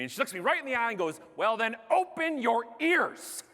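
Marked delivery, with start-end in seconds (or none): 1.4-3.4 slow, raised, deliberate put-down; cutting retort. Retold or imitated. Flight attendant scolding a complaining passenger, slow cutting retort. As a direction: space the words out to scold.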